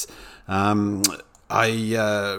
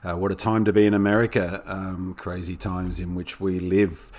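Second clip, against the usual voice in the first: usual speaking voice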